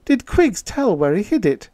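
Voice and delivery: eager question, pitched high; excited. A narrator's reading. Irene asking Peter about Quiggs, excited. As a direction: press eagerly for the answer, voice up high. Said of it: "Irene asked excitedly".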